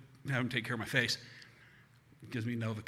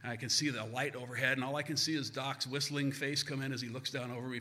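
ordinary delivery

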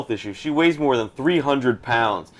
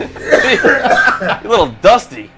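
laughing; 7 semitones higher, 8 dB louder, much slower than usual. Real, not acted. Laughing at the mummy guest.

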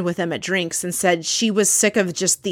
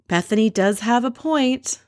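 lightly imitated confessional aside, higher pitched; a hint of someone else's voice, pitched up; Ramona; 5 semitones higher than usual. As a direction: quote her with a lightly raised voice. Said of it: "Ramona's confessional where she says".

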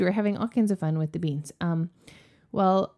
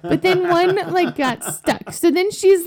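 ordinary talk, nothing marked

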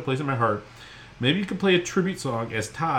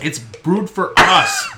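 "us" drawn out and punched; slowly, higher, landing hard on a long "us"; stress on US; 4 semitones higher, 6 dB louder, much slower than usual. Shown as a demonstration. Host corrects co-host's label reading, "us" stretched and stressed. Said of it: "That's their slogan".

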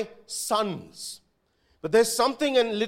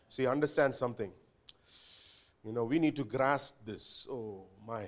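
usual speaking voice

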